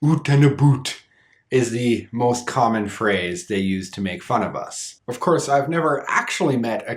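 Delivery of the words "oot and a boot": "Oot" said with a tight oo vowel, exaggerated Canadian accent. Shown as a demonstration. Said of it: "you'll hear it pronounced oot".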